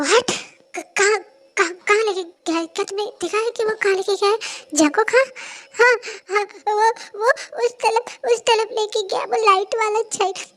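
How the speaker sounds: frantic urgent back-and-forth; urgent alarm. Acted. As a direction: rush out the questions in alarm. Urgent exchange about kidnapping.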